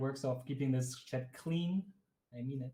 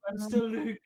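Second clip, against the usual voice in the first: mock protest; 7 semitones higher, much slower than usual. Joking about changed name.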